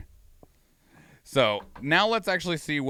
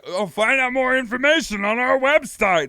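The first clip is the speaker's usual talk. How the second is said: mock developer voice; 8 semitones higher, 4 dB louder than usual. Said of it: "then they're like".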